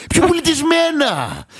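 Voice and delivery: high, slow outraged "Πιο πολιτισμένα!"; hammed-up offended customer, slowed right down; mock indignant quote. Mocking the comedian's line, exaggerated outrage. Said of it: "και λέει".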